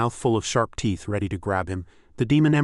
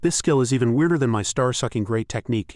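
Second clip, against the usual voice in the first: ordinary delivery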